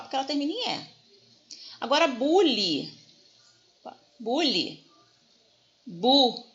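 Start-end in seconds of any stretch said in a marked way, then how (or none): 1.8-4.8 "bule" with BU stressed. Shown as a demonstration. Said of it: "a sílaba tônica tá no bu". 5.9-6.5 "Bu" said alone, loud and stressed; stressed syllable alone. Shown as a demonstration. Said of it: "a sílaba tônica tá no bu".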